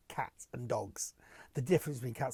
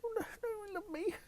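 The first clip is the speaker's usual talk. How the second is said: high, squeaky timid-creature voice; little rabbit. Acted. Vlogger playing himself as a little rabbit upset by critics, high pleading squeak. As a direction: plead like a tiny panicked rabbit. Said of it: "I'm like a little rabbit".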